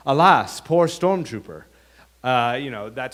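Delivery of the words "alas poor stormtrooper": mock-tragic lament, louder on Alas and slow; a light parody of Hamlet mourning a skull; hammy Shakespearean Luke. Luke holding the stormtrooper helmet, mock-tragic lament. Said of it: "holds up the stormtrooper helmet and says".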